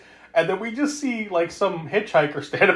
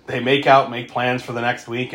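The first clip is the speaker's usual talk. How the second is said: usual speaking voice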